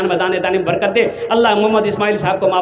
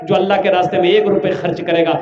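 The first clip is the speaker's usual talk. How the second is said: normal talking voice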